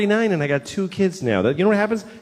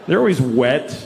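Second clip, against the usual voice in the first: normal talking voice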